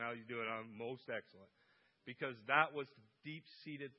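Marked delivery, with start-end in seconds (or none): 0.0-1.5 imitating dad. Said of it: "and you ask him".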